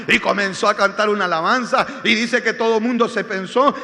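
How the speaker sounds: loud sermon storytelling at its climax; impassioned preaching narration. Real, not acted. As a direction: belt out the testimony like a preacher. (relato predicado a plena voz)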